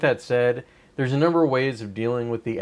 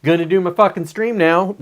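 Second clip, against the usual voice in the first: mock upbeat resolve; 7 semitones higher, 8 dB louder than usual. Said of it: "or you could be like".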